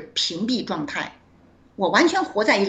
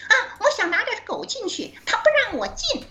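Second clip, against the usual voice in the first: imitating a classmate's piercing voice, pitched much higher; high-octave voice; 12 semitones higher than usual. Old classmate at the hotel door explaining her dog, in a much higher mock voice. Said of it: "那个高八度的声音".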